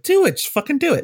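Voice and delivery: high, pushy egging on; urging shout. Retold or imitated. Brad urges Scott to approach Jim Lee, high and loud.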